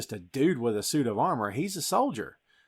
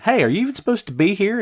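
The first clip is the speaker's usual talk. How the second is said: playful Downey tease; 7 semitones higher, 8 dB louder, faster than usual. Retold or imitated. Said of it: "Downey's way of saying".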